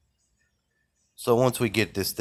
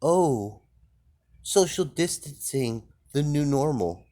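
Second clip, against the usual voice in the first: higher, mincing mock of a scolding liberal; mocking parody; 7 semitones higher, slower than usual. Ridiculing TV Democrats by parroting their slogans mockingly. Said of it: "You wanna fucking be all".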